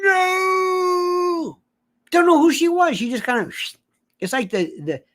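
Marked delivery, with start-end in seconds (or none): 0.0-1.6 high wailing "No!" imitation; hammed-up sobbing "No!"; mock crying "No!". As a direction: wail it like the famous meme. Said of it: "the first Karen".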